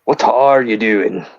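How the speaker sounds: slow, low, badly faked Scottish; botched Scottish accent. Co-host trying to make friends break, botched Scottish accent. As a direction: stretch it out in a dubious Scottish accent. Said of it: "what the hell was that Scottish accent".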